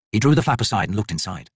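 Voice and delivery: plain reading voice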